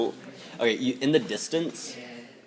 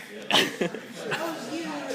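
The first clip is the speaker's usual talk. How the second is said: clattering jaw cackling skull; 7 dB quieter than usual. Acted. Skeleton laughs from the ground.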